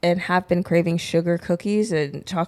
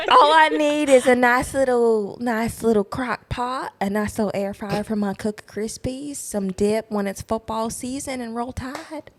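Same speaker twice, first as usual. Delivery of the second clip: put-on Southern accent; 2 semitones higher than usual. Country woman listing kitchen and football needs, Southern accent. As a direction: ham up a homespun Southern twang. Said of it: "that's literally how I'm talking at work".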